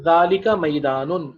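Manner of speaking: clear, stretched model answer; slow model answer. Shown as a demonstration. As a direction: model ذالکا میدانن slowly for the class. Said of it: "teacher confirms answer".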